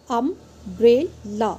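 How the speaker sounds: "umbrella" drawn out in three slow beats; cut into syllables. Shown as a demonstration. Teacher cutting the word for students, "umbrella" syllable by syllable. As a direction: chop "umbrella" into um, brel, la. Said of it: "word को cut कर लेंगे".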